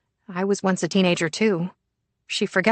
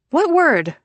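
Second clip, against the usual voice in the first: put-on teen daughter voice; 11 semitones higher, 5 dB louder than usual. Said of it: "she asks".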